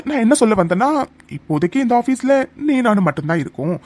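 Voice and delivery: ordinary talk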